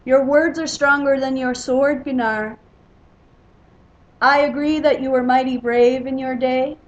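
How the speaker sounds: loud, jeering challenge across the hall; loud taunt. A narrator's reading. Rival mocks Gunnar's sword skill, loud taunt. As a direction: raise the voice to needle a rival.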